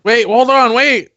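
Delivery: high, loud plea to stop; calling after her. Acted. Brother calls after his sister as she storms off, loud and high. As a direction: call out to stop her leaving.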